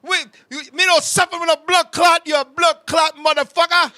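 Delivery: put-on loud, high Jamaican accent cursing; Jamaican accent shouting. Jamaican man cursing out the nurse. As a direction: roar it in a Jamaican accent like you've been insulted. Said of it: "Jamaican guy comes".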